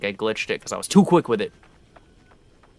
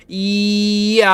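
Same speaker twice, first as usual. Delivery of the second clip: high drawn-out; 10 semitones higher, 8 dB louder than usual. Real, not acted.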